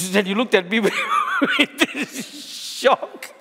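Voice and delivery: laughing through the punchline; can't hold back the laughter. Real, not acted. Pastor laughing while recounting his bribe joke.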